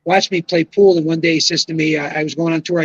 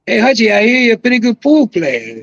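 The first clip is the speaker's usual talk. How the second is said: put-on Cajun accent, pitched up; imitated Cajun accent; 4 semitones higher, 3 dB louder than usual. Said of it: "one day in this accent".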